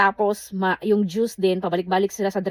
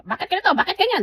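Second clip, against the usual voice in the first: demanding customer; 10 semitones higher, faster than usual. Retold or imitated. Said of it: "may mga customer talaga yun na demanding".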